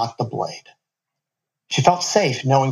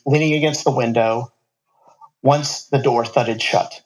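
ordinary delivery

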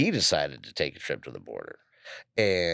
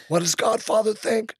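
much higher put-on voice of the Generation Kill character; Godfather impression; 5 dB louder than usual. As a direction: squeak it out like Godfather from the show. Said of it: "We could talk like Godfather on Generation Kill".

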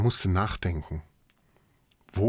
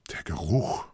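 breathy, hushed half-question; whispered realisation; 2 semitones lower than usual. Acted. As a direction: breathe it out, puzzled. Sudden realisation, whispered thought.

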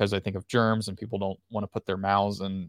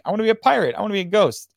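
put-on high, louder, eager kid quote; lightly mimicked trick-or-treater, pitched up; kid picking a costume; 10 semitones higher, 6 dB louder, faster than usual. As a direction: pipe up like a child listing costume wishes. Voices kids choosing costumes.

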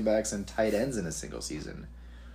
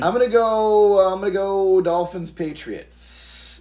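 loud announcer voice; 10 semitones higher, 9 dB louder than usual. Pretend.

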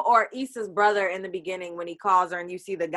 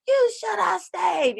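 much higher, slow, drawn-out shout; yelling at TV; 10 semitones higher, slower than usual. Retold or imitated. Fan yelling at Nathan on her TV, slow shout. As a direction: scold him through the screen. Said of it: "I'm just yelling at my T V".

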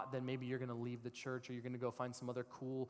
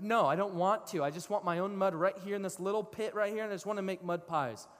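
raised, louder mock protest; mildly childish refusal; 6 semitones higher, 6 dB louder than usual. You refusing God's bigger offer, mock stubborn. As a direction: lightly play a stubborn kid turning down an offer.

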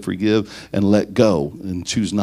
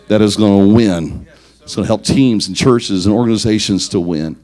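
preaching climax; 5 dB louder than usual. Acted. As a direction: drive the message home to the congregation. Preacher's closing exhortation.

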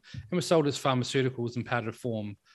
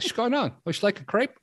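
high French sailor voice; 11 semitones higher, 3 dB louder than usual. Retold or imitated.

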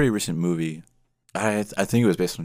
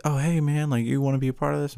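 a higher, friendly mock recruiter voice; hammed-up Klan recruiter; 7 semitones higher than usual. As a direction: play the eager recruiter on the phone, lightly. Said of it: "they're like".

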